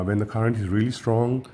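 normal talking voice

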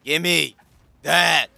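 word-by-word shout building in volume; punched, separated words rising to a yell; forceful demand. Real, not acted. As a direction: demand it, pausing between words, last word held loud. Demanding the ball mid-game.

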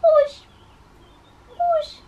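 tiny, very high squeak; squeaky mouse. Shown as a demonstration. Teacher plays a mouse for the class, squeaky voice. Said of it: "do it like a mouse".

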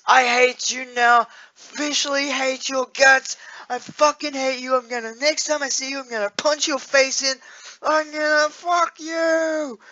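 high mock-furious threats, hammed up; mock angry partner. Voicing her comments on the video, high hammed-up mock anger. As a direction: play her fake rage, high and pushed. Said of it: "she comments".

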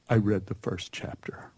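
a small ashamed whisper; whispered confession. Retold or imitated. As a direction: barely breathe the words out, caught. Trembling child admits the truth.

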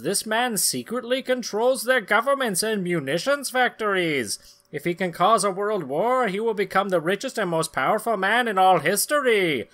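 high, loud pulp-comic narrator; dramatic comic narration. Acted. Narrator introducing the villain, high and dramatic. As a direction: announce it like a breathless old serial.

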